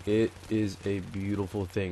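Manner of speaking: measured, drawn-out emphasis on each word; slow, deliberate repeat. Real, not acted. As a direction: space out "It is a beautiful thing" word by word, quietly. Repeats line slower for emphasis.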